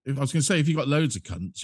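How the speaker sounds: ordinary talk, nothing marked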